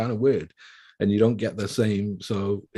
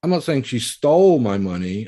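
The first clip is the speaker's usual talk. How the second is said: raised, louder emphasis on "stole"; stress on STOLE; 4 semitones higher, 8 dB louder than usual. Shown as a demonstration. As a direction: punch the word "stole" hard. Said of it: "she didn't steal it".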